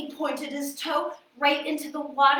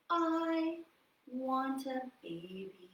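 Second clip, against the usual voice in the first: wistful wish; 7 dB quieter, slower than usual. Shown as a demonstration. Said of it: "Can you say".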